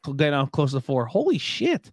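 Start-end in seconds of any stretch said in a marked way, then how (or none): 1.1-1.9 amazed. Real, not acted. Amazed reaction.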